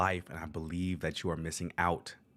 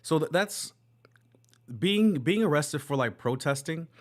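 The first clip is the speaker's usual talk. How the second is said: shocked; 8 semitones higher, 5 dB louder than usual. Real, not acted. Shocked reaction continues.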